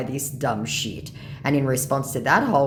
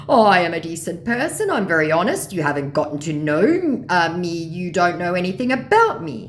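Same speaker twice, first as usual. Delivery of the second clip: loud, raised scammer voice; indignant mock scammer; 5 semitones higher, 6 dB louder than usual. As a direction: protest your decency in caricature. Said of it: "he said".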